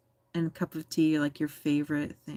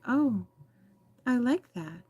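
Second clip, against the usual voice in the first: higher-pitched, naive admiration; imitated pleased passer-by, pitched up; mock admiring; 6 semitones higher than usual. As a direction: coo over it like an unsuspecting admirer. Voices people admiring a pretty flower.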